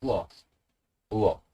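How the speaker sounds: "wo" as u and o run quickly together; each "wo" one short syllable; vowels blended fast. Shown as a demonstration. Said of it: "If we pronounce it fast".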